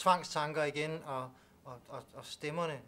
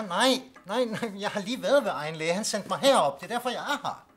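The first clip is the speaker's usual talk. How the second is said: louder and higher, frustrated he isn't heard; exasperated loud protest; 5 semitones higher, 8 dB louder than usual. Acted. Patient to distracted doctor, loud exasperated protest. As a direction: protest hard, voice climbing.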